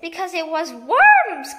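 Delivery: loud, slow gloating shout; triumphant cry. A narrator's reading. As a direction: bellow the reveal, savouring every word. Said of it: "cried Missus Twit".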